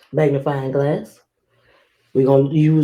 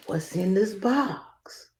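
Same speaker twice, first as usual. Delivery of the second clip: whisper; 4 semitones higher, 9 dB quieter, slower than usual. Acted. Teasing mystery box to kids.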